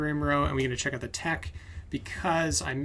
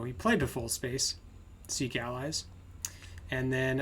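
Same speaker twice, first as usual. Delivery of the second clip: usual speaking voice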